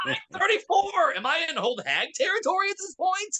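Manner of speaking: high-pitched, wounded protest; hammed-up offended squeal; comic indignation. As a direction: squeak it out as if personally insulted. Comic outrage about own age.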